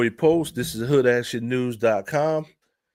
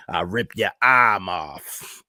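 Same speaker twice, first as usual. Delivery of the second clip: Boston Strangler voice; 5 semitones lower, slower than usual. Retold or imitated. Savoring the old man's line, slow low mock menace. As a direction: repeat the threat like a creepy old man. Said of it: "Sound like the Boston Strangler".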